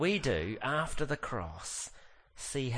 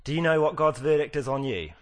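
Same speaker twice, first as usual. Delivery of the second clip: louder, higher, stressing "know"; pointed question; 4 semitones higher, 8 dB louder, faster than usual. Shown as a demonstration. Preacher confronting the congregation, loud and pointed. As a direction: fire the question "Do you know" at the hearers. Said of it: "I asked her this yesterday".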